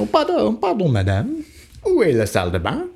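a hint of a keen tourist; eager beginner French. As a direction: try out classroom French on a shopkeeper. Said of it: "day one French stuff".

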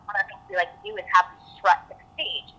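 ordinary delivery

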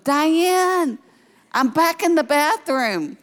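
high, drawn-out mock holler; acted yelling. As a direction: re-enact calling out for help from another room. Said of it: "I'm yelling".

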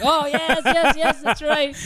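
very high, laughing yeses; laughing agreement. Real, not acted. Delighted at co-host's Professor Lupin joke, laughing.

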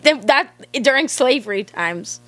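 normal talking voice

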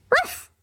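very high-pitched imitated woof; one short, high bark; dog bark. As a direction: yip like a small dog. Voices the dog's bark.

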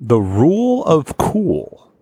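slow, savoring echo of "The rule of cool"; drawn out approval. Real, not acted. Host echoing the slogan, slow savoring.

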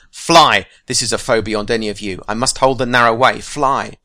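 loud, urgent wizard's command; commanding shout. Acted. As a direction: drive them away with force. Gandalf commands fellowship to flee.

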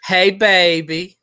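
drawn-out Southern endearment; slow, hammed-up Southern accent; mock Southern accent. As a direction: stretch it like a flirty Southerner.

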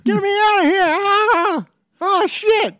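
high, loud frantic squeal; panicked fridge. Acted. Exposed fridge begging to escape, high and loud. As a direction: shriek to get out.

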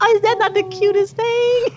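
high, gushing delight. Real, not acted. Aunt gushing to co-host about niece's sweet question, high and delighted. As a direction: squeal over how cute it is.

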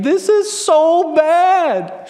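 put-on high, slow, drawn-out child's whine; whiny little girl. Pastor imitating his daughter whining from the other room, high and slow. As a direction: whine it out like a four-year-old over nothing. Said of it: "I just kinda hear her whining".

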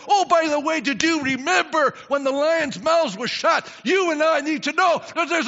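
rising to a loud, high preaching shout; building sermon cry. Acted. Israel recounting God's wonders.

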